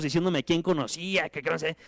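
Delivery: ordinary talk, nothing marked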